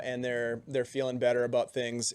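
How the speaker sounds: usual speaking voice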